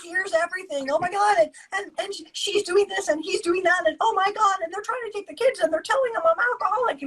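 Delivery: high, frantic gush of words, fast; story barfing. Retold or imitated. Divorcee unloading everything on a churchgoer, frantic and fast. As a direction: pour out every grievance in one breathless rush. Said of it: "I call it story barfing".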